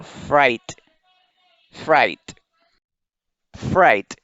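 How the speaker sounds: "fright" as "frait", GH silent. Shown as a demonstration. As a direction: say "fright" with the English "eye" vowel and no G or H. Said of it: "esta G y esta H son mudas". ("fright" como "frait", G y H mudas)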